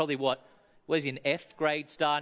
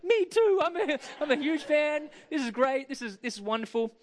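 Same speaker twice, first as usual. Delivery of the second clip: high, loud gushing fanboy voice, pitched way up; starstruck gushing; 12 semitones higher, 4 dB louder than usual. Retold or imitated. Meeting Matt Damon, telling him how thrilled he is, in a high, loud, gushing voice. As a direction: gush like you've just met your hero. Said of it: "I'm like".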